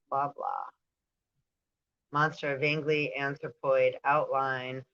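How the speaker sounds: ordinary talk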